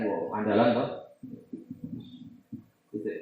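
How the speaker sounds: ordinary delivery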